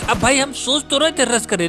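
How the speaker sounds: normal talking voice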